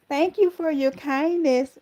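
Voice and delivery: high, drawn-out teasing imitation; Angela's catchphrase. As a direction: stretch it out high, poking gentle fun. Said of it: "She always said it".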